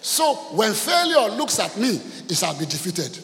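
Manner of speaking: loud, slow opening that eases down; preaching declamation. Acted. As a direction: declare victory to the congregation. Preaches victory to congregation.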